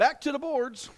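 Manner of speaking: loud, high announcer call; announcer catchphrase. Real, not acted. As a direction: punch out the catchphrase.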